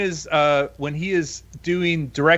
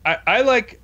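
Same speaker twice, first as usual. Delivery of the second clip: normal talking voice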